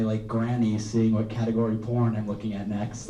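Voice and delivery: ordinary talk, nothing marked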